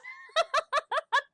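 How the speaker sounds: loud high laugh; squealing laugh at her own excitement; high burst of laughter. Real, not acted.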